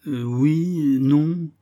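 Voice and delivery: high, wavering drawn-out hesitation; flustered. A narrator's reading. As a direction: dither between answers, stretching each word. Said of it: "ne sachant plus ce qu'il disait".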